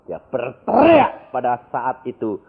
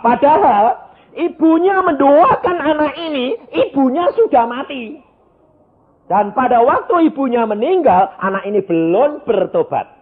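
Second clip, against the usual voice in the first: full-force preaching; 13 semitones higher, 9 dB louder than usual. Acted. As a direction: preach it out over the congregation. Preacher tells testimony.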